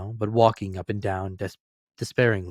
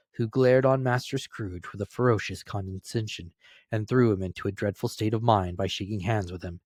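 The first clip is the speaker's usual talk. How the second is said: plain reading voice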